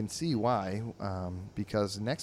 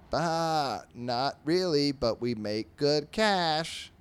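higher bleating sheep, slow on the last words; 5 semitones higher, 4 dB louder, much slower than usual. Acted. Sheep telling the pig about the money, bleating and slow. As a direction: bleat it, then drag out the cash line.

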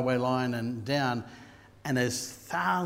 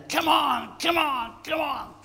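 cheering on; 13 semitones higher, 3 dB louder, slower than usual. Retold or imitated. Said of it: "And I'm there".

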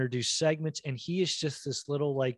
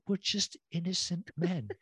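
lightly imitated drunk, higher and softer; mock drunk plea, raised pitch; drunk buddy; 4 semitones higher, 5 dB quieter than usual. Drunk buddy answering the cop, mock drunk. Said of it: "his drunk buddy".

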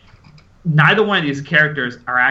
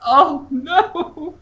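mock dismay. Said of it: "It's like".

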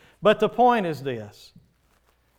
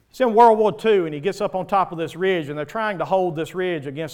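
loud preaching; 3 semitones higher, 7 dB louder than usual. Acted. Preaching the movie story loudly.

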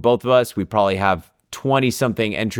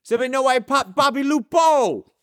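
high, put-on Italian New York accent; bad Italian New Yorker accent; 13 semitones higher than usual. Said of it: "a really bad Italian New Yorker accent".

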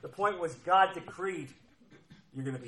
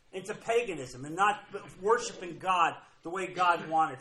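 usual speaking voice

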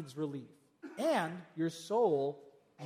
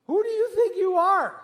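much higher and louder, challenging; incredulous rebuke; 10 semitones higher, 8 dB louder than usual. Real, not acted. Preacher confronting workaholics, loud incredulous rebuke. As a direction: fire the question at them.

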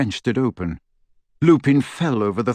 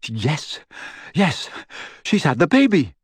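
shouting; 4 semitones higher than usual. A narrator's reading. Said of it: "shouted Lupin".

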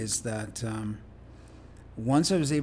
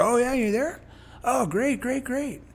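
put-on much higher, eager old friendly man; imitating Ron, eager; 11 semitones higher than usual. Guest imitating Ron hearing where he lives, much higher and eager. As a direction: chirp it out, delighted. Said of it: "And he's like".

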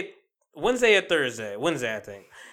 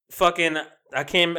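ordinary delivery

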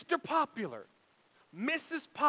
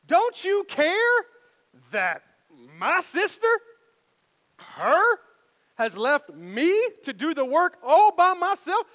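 much higher and louder, slow complaining plea; whiny Martha; 8 semitones higher, 11 dB louder, slower than usual. Retold or imitated. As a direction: play the fed-up sister. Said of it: "she comes to him and she says".

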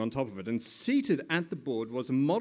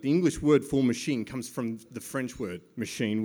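ordinary delivery

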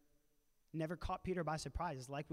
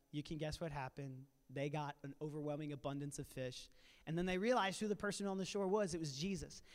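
ordinary talk, nothing marked

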